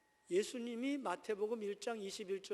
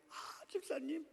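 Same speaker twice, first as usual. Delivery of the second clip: feigned innocent greeting; 7 semitones higher, slower than usual. Acted. Pastor hides a marital fight from a visiting deacon, feigned innocence. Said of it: "갑자기 이렇게 선하고 깨끗한 얼굴".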